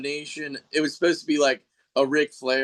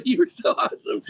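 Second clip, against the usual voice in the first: much higher, gushing praise; higher gushing as a fan praising his childhood hero; starstruck fan; 10 semitones higher, faster than usual. Retold or imitated. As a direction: fawn over your hero. Retelling meeting childhood wrestling hero.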